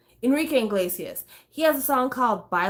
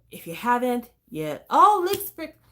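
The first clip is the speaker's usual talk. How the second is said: ordinary talk, nothing marked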